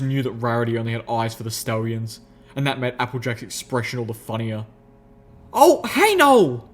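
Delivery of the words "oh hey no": high, loud flustered yelp; near shout. A narrator's reading. Applejack to Rarity, caught off guard, near shout. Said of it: "Applejack nearly shouted, backpedaling".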